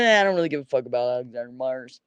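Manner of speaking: put-on lazy drunken low voice; imitating drunk Pipes. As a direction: mimic a drunk guy brushing it off. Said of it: "Pipes doing his drunk shit".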